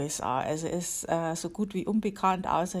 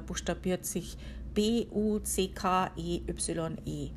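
normal talking voice

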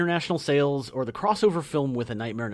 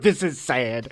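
laughing through the quote; 4 dB louder, slower than usual. Real, not acted. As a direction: giggle the words out.

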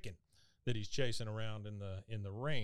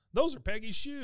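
much higher, louder nasal-free Dale impression; 12 semitones higher, 6 dB louder than usual. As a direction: pipe up like a know-it-all neighbor. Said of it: "Dale pipes up and goes".

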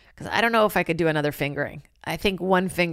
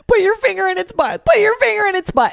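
much higher, loud frantic shout; retold hysterical yell at a dog attack; 7 dB louder than usual. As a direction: imitate a friend shrieking orders. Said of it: "She's hysterical".

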